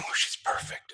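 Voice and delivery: soft unvoiced whisper to a partner; wife whispering beside him during the interview. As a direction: lean in and breathe it so only he hears.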